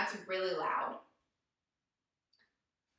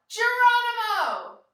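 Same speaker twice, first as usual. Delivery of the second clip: loud high Geronimo yell; 10 semitones higher, 6 dB louder, slower than usual. Shown as a demonstration. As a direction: shout it as you jump. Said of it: "we're gonna yell".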